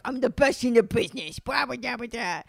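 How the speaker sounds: mock-aggressive bragging contestant; hammed-up cocky competitor. Chopped contestant boasting about being best, aggressive. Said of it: "everything's so aggressive".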